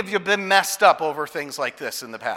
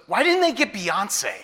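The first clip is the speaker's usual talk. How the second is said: indignant daughter; 7 semitones higher than usual. Retold or imitated. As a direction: demand it like a put-out little girl. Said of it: "She goes".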